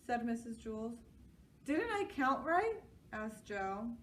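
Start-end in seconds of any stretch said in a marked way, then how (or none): 1.6-2.8 put-on high eager boy's question; child voice. A narrator's reading. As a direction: ask it like an eager kid, high. Joe puzzled.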